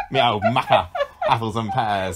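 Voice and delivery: hammed-up rhyming-slang accent; loud, jokey mock accent; Bradley Walsh. Host tacking slang onto an actor's quote, hammed-up accent. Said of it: "He sounded exactly like that".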